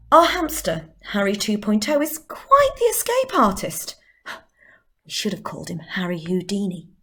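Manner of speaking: swooping pitch, quizzical in the middle; expressive monologue. Acted. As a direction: deliver it with theatrical pauses. Final delivery of monologue.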